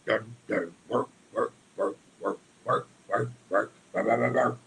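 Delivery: rhythmic caveman grunt-chant; caveman army. Acted. Army of cavemen inside his head, chanting grunts in cadence. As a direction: pound out each syllable like marching cavemen. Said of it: "an army of just cavemen inside".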